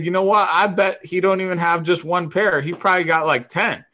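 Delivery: high-pitched, animated wager; worked-up teasing, pitched well up; needling challenge. Retold or imitated. Retold needling of a teammate.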